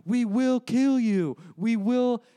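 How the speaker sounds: slow, loud, high mock threat; hammed-up threat read from a president's tweet; all-caps threat. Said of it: "boom, boom, boom, paragraph of like".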